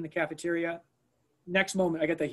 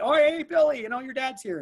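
calling out; 8 semitones higher, 7 dB louder than usual. Retold or imitated. Calling kids over the radio.

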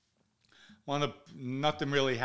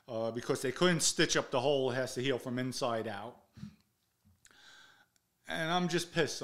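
ordinary delivery

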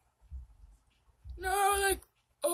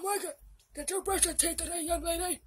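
ordinary talk

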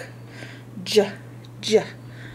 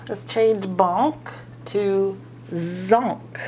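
usual speaking voice

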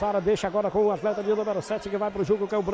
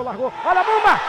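rising excitement; 8 semitones higher, 10 dB louder than usual. Real, not acted. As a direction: voice climbs as the shot flies. Shot goes in, crowd moment. (empolgação crescente)

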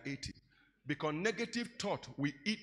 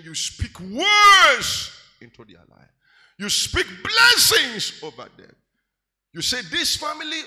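preach; 7 semitones higher, 17 dB louder, slower than usual. Real, not acted. Preaching at full force.

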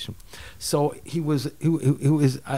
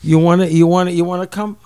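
higher, louder friendly invitation; eager friend; 5 semitones higher, 5 dB louder than usual. Retold or imitated. Friend Bob calls to invite him to the shul. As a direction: invite him over the phone with enthusiasm. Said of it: "he called me up that night".

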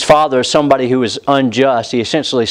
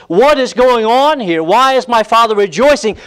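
indignant demand; 7 semitones higher than usual. Acted. Elder son voiced demanding answers.

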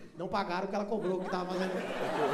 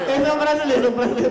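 much louder, laughing declaration; loud outburst; 3 semitones higher, 12 dB louder, much faster than usual. Real, not acted. Mocking the Brazilian tourist, loud and laughing. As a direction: belt it out mid-laugh.

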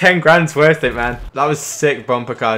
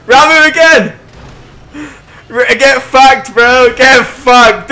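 high, loud jubilant yelling; triumphant shout; 10 semitones higher, 9 dB louder, slower than usual. Real, not acted. Player celebrates a sick ram, triumphant shouting. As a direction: yell the victory out at full tilt.

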